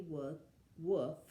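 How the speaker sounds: ordinary delivery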